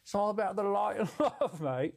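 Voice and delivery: a light, loose Irish-Australian bloke; vaguely Irish-Australian accent. Acted. Streamer rambling about love and light in a mixed accent. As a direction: play a stoned, mellow Aussie-Irish guru. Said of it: "vaguely Irish slash Australian man".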